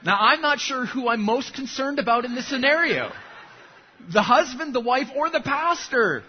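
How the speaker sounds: chuckling at the punchline; laughing. Real, not acted. Laughing at own joke.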